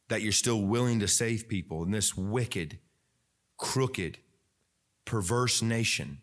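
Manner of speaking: low, measured praying voice; subdued, reverent address to God; closing prayer. Real, not acted. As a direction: pray earnestly, phrase by phrase.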